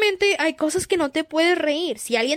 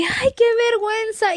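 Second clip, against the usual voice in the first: high, groaning "qué vergüenza"; hammed-up cringe; mock embarrassment; 7 semitones higher than usual. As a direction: whine about the shame. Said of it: "tomártelo de que".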